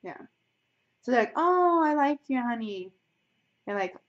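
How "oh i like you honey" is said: high, drawn-out "oh", then sliding down; mock sweet gushing. American telling someone they like them, mock sweet gushing. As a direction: gush slowly with fake affection.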